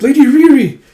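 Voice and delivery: high, loud cry of a name; alarmed cry. Acted. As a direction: call out in horror. Servant sees mistress at platform.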